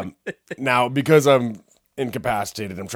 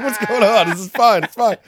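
loud, high-pitched mock panic; hammed-up flustered reassurance; retold panicked protest; 12 semitones higher, 10 dB louder than usual. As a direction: protest loudly to the cops in a raised pitch.

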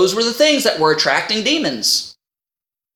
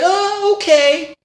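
high sing-song oh, okay; mock-naive oh okay; 8 semitones higher, much slower than usual. To his manager, a high sing-song oh okay. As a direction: play dumb to avoid obeying. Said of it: "I said".

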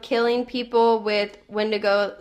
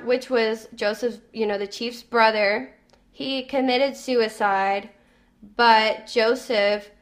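ordinary delivery

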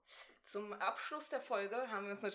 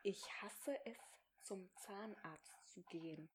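soft mouthing; 3 semitones lower, 11 dB quieter, slower than usual. Shown as a demonstration. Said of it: "mouthed for lip-reading".

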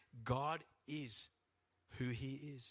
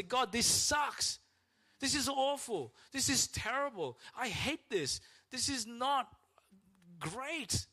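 much higher and louder, slow angry whinge; complaining to God; 12 semitones higher, 5 dB louder, slower than usual. Retold or imitated. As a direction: pour out the frustration to God. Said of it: "go to God and say".

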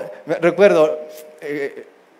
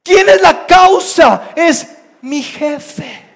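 loud, high, drawn-out accusation; exasperated; 10 semitones higher, 9 dB louder, much slower than usual. Acted. Fed-up employee names the boss as the cause, exasperated. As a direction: blame it on someone, each word stretched.

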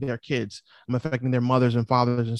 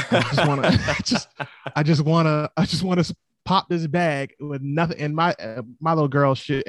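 normal talking voice

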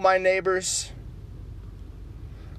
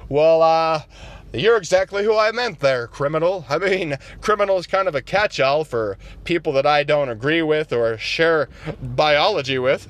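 lower, folksy conservative voice; low Republican caricature; 5 semitones lower than usual. Acted. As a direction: talk down low like a good ol' boy. Republican character again.

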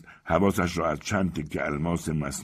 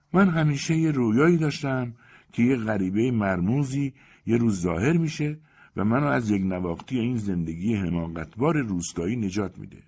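put-on higher, dreamy young woman's voice easing down; a romantic provincial wife sharing her longing; lightly voiced yearning heroine; Emma Bovary; 6 semitones higher than usual.

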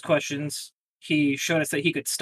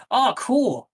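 sarcastic; 8 semitones higher, 3 dB louder than usual. Retold or imitated. Father's dismissive reaction.

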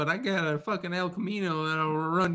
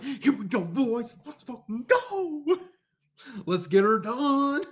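riled-up hillbilly; 10 semitones higher than usual. Retold or imitated. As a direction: rile yourself up like a good old boy. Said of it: "you get riled up about something".

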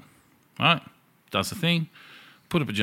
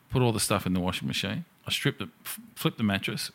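ordinary delivery

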